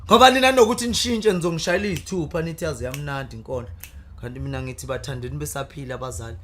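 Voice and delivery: loud declaimed praise poetry; poem recitation. Acted.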